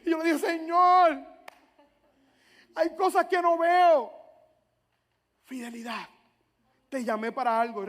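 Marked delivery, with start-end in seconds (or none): 0.4-4.1 loud, high, stretched plea; crying out to God. Retold or imitated. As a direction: call out to heaven in frustration. (clamor fuerte y frustrado a Dios)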